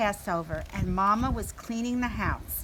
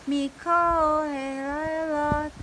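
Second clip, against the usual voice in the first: higher, slow squeaky parrot echo; 6 semitones higher, 5 dB louder, slower than usual. A narrator's reading. Parrot copies Lily's Hebrew in squeaky voice. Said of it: "The parrot squeaked right after me".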